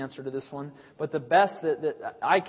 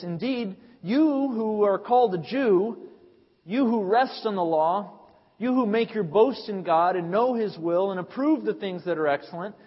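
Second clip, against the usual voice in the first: louder, higher, declaiming the passage; fervent preacher reading Romans aloud; preaching scripture reading; 4 semitones higher, 3 dB louder than usual. Acted. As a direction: read it like a charge against the listeners.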